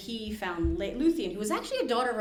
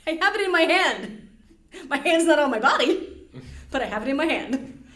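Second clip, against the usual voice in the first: louder, higher, smug hero showing his stump; triumphant Beren; 5 semitones higher, 8 dB louder than usual. Retold or imitated. Podcast host retelling Beren's joke to his father-in-law, loud and smug. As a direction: announce it proudly, a bit louder and higher.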